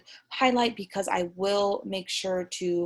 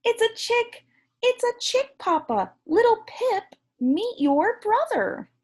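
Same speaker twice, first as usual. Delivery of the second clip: much higher, louder, thrilled little penguin; excited little penguin; 13 semitones higher, 4 dB louder than usual. Acted. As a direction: squeal it out like a delighted chick. Excited chick voice.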